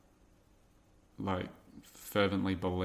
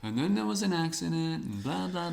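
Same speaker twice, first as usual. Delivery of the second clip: high, whiny excuse-making; mock whiny excuse; 8 semitones higher, 5 dB louder than usual. Teacher mocking his own traffic excuses, high and whiny. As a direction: put on a pitiful excuse voice.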